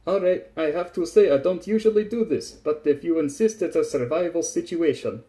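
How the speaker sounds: hammed-up sailor justifying himself; Tachanka, reluctantly agreeing. A narrator's reading. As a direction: make excuses while giving in.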